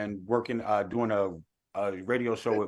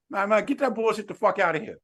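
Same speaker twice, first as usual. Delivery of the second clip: higher, hammed-up dismissive voice; 9 semitones higher than usual. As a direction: wave it away with a put-on star sneer. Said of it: "He's like".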